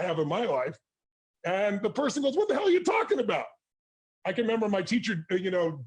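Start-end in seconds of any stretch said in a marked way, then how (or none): 2.4-3.5 baffled retort. Retold or imitated. Accused friend hitting back at a confrontation, baffled. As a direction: sound genuinely thrown by the accusation.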